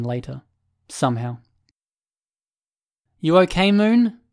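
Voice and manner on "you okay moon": raised, high calling-out; hollered. A narrator's reading. Byron checking on a team-mate, hollered question. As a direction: call out with the pitch climbing at the end. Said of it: "he hollered".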